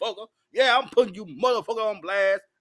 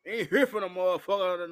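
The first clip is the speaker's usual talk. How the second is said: normal talking voice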